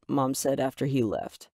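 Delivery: ordinary delivery, nothing marked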